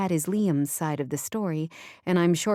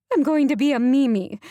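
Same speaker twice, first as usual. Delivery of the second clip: higher and louder, bursting with delight; overjoyed; 9 semitones higher, 7 dB louder than usual. Acted. New grandmother telling the narrator, delighted outburst. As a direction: squeal out the joy of becoming a grandmother.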